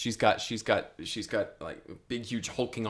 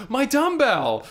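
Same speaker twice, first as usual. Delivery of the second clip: high, loud mock squeal; high-pitched comic exclamation; excited outburst; 6 dB louder, slower than usual. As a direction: cry it out in exaggerated delight, pitched way up. Acted delighted outburst in story.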